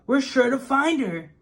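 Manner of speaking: put-on high, eager boy voice; child voice. A narrator's reading. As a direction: say it like a hopeful young kid. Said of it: "said Bob".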